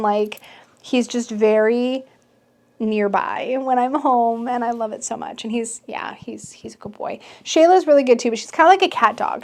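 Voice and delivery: ordinary talk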